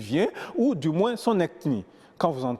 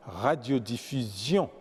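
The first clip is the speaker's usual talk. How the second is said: slow "radiodiffusion", last syllable stressed; accent sur la dernière syllabe; 5 semitones lower, 4 dB quieter, slower than usual. Shown as a demonstration. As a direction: let "radiodiffusion" land on its ending. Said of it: "l'accent tombe à la dernière syllabe". (« radiodiffusion » lent, accentué sur la finale)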